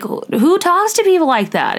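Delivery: high, incredulous outrage; who would say that, disbelief. Real, not acted. Host reacting to the story, incredulous. Outraged at rude yacht owner.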